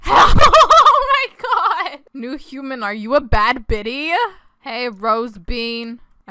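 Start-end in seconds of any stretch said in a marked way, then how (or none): 0.1-2.0 high, loud, laughed-out exclamation; shrieking laughter. Real, not acted. Player thrilled at stealing a human, shrieking laughter. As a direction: burst out laughing through the words.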